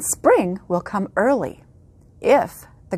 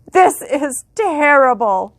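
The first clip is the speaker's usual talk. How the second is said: higher, louder dismayed mayor; mayor crying out; 6 semitones higher, 5 dB louder than usual. Acted. The mayor despairing over failed groundhog auditions. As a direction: wail it like a worried mayor. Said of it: "the mayor cried".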